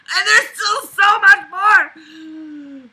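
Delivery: half laughing, half sobbing, high and loud; despairing laugh-yell; laugh-crying shout. Real, not acted. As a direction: wail it slowly through laughter. Frustrated laughing, crying outburst.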